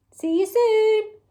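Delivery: ordinary delivery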